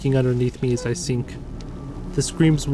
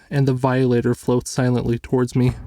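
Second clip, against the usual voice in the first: ordinary delivery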